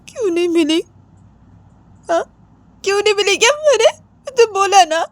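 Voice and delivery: high, crying challenge; upset and insistent, very high; tearful demand. Acted. Repeated tearful demand in quarrel.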